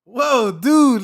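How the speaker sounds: loud, high surfer-dude whoa; hammed-up bro greeting; excited dude. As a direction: gush like a laid-back surfer. Mock small talk.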